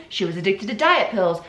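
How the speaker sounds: ordinary delivery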